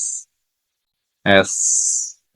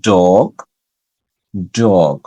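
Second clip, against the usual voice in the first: firm, forceful D opening "ডগ"; emphatic D; 3 semitones lower, much slower than usual. Shown as a demonstration. Teacher drilling the D sound for learners, emphatic D. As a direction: push the first consonant of "ডগ", pitch falling after. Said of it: "ডিটা একটু জোরে বলবো".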